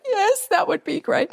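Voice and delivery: high, teary yes; grateful words squeezed out through tears. Retold or imitated. Accepting the boss's offer to meet, high and teary. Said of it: "through my tears".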